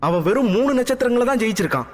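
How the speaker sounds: high, fast, near-tears plea; hint of crying while protesting; tearful voice. A narrator's reading. Daughter pleads with her father, high, fast, a touch tearful. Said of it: "என்றாள் அழுகை கலந்த குரலில்".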